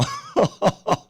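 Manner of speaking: short chuckle at own joke; laugh. Real, not acted. Laughs after his own joke.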